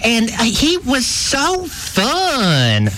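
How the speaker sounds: high, bright-eyed wife voice in an ad; Jeff Coleman's wife. Retold or imitated. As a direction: play the proud smiling wife. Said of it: "This is his wife".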